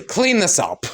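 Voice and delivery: mock drunk command to clean up; drunk snapping order. Drunk father orders daughter.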